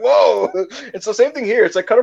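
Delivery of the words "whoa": surprised whoa. Retold or imitated. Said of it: "You're like".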